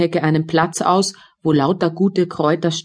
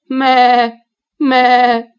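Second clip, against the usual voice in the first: loud, higher, complaining goat bleat; a loud mock bleat, higher and louder; 5 semitones higher, 6 dB louder than usual. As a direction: bleat it out like a hungry, lying goat.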